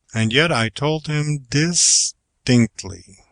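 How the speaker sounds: "distinctly" drawn out slowly with a falling end; inflection on last syllables. Shown as a demonstration. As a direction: let the last syllables of "distinctly" drop firmly. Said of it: "making no modulation until you come to the last two syllables".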